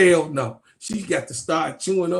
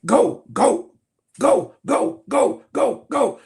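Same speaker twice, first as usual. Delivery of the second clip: urgent coach yelling; 7 semitones higher, 7 dB louder, slower than usual. Retold or imitated. Voicing coach urging player.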